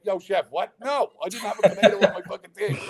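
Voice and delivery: high, laughing protest; mock indignant self. To Gordon, a laughing indignant protest. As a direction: protest through a laugh at the chef. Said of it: "And I'm like".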